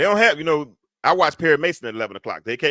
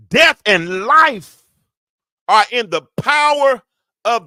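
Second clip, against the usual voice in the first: ringing sermon delivery, pitched up; impassioned preaching; 8 semitones higher than usual. Real, not acted. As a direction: proclaim it to the congregation, stretching key words.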